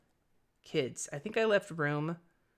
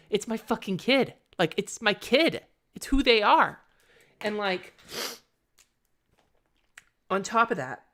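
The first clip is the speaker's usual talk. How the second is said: raised, high and emphatic; passionate; 5 semitones higher, 5 dB louder than usual. Real, not acted. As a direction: insist on it with feeling. Passionate defence of her kid.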